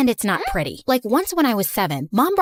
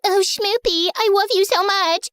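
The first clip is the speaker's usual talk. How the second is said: put-on higher, sugary girlfriend coo; higher mocking baby-talk girlfriend; 8 semitones higher than usual. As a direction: gush like a lovestruck girlfriend, mocking her. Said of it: "started baby talking in my face".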